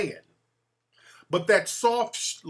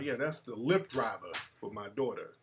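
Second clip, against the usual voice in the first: usual speaking voice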